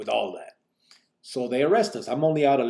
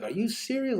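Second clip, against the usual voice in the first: much higher, stunned question; surprised disbelief; 11 semitones higher than usual. Retold or imitated. Casper learning he was found not guilty, high stunned disbelief. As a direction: sound floored by the news. Casper hears he beat charge.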